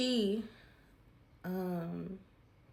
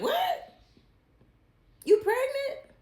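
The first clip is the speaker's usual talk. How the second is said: imitating dad's very high, loud shocked cry; shocked disbelief; 9 dB louder, slower than usual. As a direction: shriek it in total disbelief. Dad learns she is pregnant.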